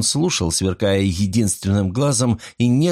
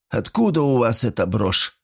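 plain reading voice

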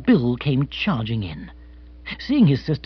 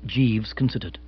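ordinary delivery, nothing marked

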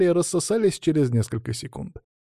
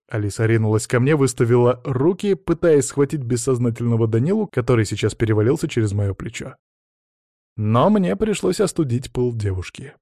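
usual speaking voice

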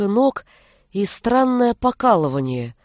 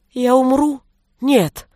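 unsure, frightened; 4 semitones higher, 3 dB louder, slower than usual. A narrator's reading. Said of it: "В голосе его не было уверенности".

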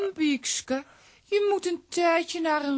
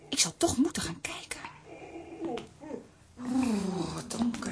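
bang fluisteren; 3 semitones lower, 10 dB quieter than usual. Acted. Wiske sluipt angstig naar kelder.

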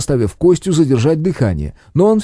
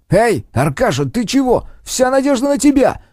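barking; 9 semitones higher than usual. Acted. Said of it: "рявкнул Костя".

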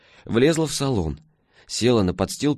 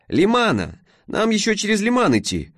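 перекрикивая мотор; 12 semitones higher than usual. A narrator's reading. Алёна в машине.

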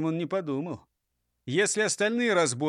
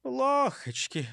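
high, drawn-out lament; sighing; 9 semitones higher, slower than usual. A narrator's reading. Bird laments Easter without eggs, high slow sigh. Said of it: "вздохнул Густав Гейер".

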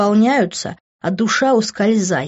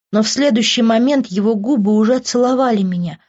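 ordinary delivery

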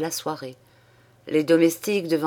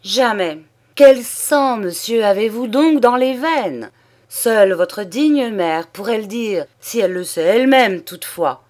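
cri furieux, bien plus fort et plus haut; colère du comte; 4 semitones higher, 6 dB louder than usual. Acted. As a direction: crache l'insulte avec fureur. Père furieux insulte son fils. (le comte furieux accable son fils)

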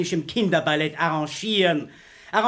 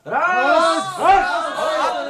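shouting; 9 semitones higher, 4 dB louder than usual. Acted. Throwing someone out, shouting.

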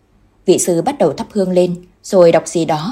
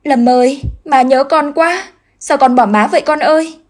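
put-on wailing out her grief for her boy; loud wailing; 8 semitones higher, 4 dB louder than usual. A narrator's reading. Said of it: "không kìm nén được nữa mà khóc to".

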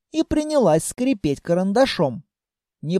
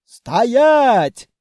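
loud, raised halt command; shouted order; 4 semitones higher, 6 dB louder than usual. A narrator's reading. Grandmother calls after the fleeing throne, shouted order. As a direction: yell it after someone running away. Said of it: "неслось нам в спину".

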